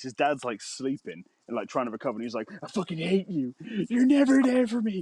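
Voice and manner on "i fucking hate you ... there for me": mock yelling son. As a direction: throw a bratty tantrum at him. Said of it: "like, yelling in his face".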